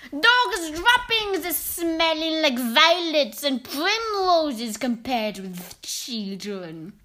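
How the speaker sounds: slow, theatrical witch voice. Acted. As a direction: sneer it out slowly like a villainous witch. Grand High Witch speech.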